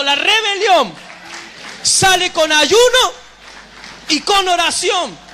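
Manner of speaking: loud, slow, hammered preaching cry; booming sermon climax on sale con ayuno; preaching shout. Real, not acted. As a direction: drive each word home to the crowd.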